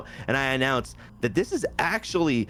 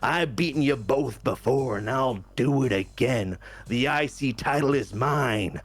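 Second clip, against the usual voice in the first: gravel-edged wrestling promo voice; Macho Madness voice. Acted. Macho Madness threatening Punk and Logan Paul, raspy promo. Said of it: "the accent's gonna go".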